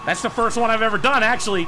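ordinary talk

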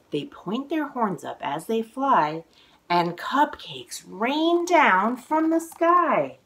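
lively, sing-song picture book reading; reading to children. A narrator's reading. Author reading her book aloud to children, sing-song. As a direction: read the rhymes with bounce for little listeners.

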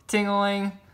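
ordinary delivery, nothing marked

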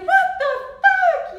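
very high, very loud, drawn-out shriek; outraged shriek. Retold or imitated. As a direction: screech it in disbelief and fury. Her own outraged reaction retold.

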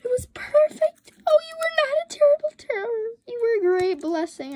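high, loud hammed-up sobbing wail; mock crying wail. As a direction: overact heartbroken grief. Mourns a broken item.

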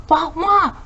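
high-pitched imitated dog bark; loud bark. A narrator's reading. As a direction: voice a barking dog in a story. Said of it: "Hachiko barked loudly".

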